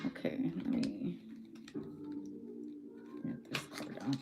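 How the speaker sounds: barely any voice left, breathy and faint; whispering because the voice is gone; losing voice. Real, not acted. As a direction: voice giving out into a near whisper. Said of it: "Now I'm starting to lose my voice".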